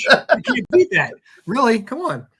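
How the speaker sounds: high, laughing excitement; excited. Real, not acted. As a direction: hype it up with a laugh. Laughing, hyped joke.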